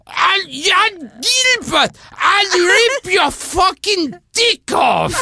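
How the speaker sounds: put-on slow, high, furious shout in an older woman's voice; yelling. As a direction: roar the threat like a she-wolf. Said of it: "she yelled out".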